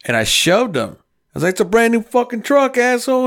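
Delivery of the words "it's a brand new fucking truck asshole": high, heated protest; retold indignant outburst. Storyteller tells Art off over his truck, high indignant outburst. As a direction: re-enact an angry objection.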